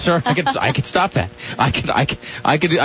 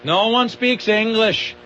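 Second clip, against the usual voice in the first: mock whiny voice; 6 semitones higher, slower than usual. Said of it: "You tell them, it's like".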